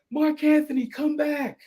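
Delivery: high called-out name; casting director calling him back into the room. Retold or imitated.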